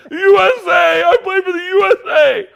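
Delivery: high, loud crying through the words; real sobbing. Real, not acted. Host retelling a movie scene, really crying. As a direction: choke out the line in real tears. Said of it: "I'm sobbing, crying".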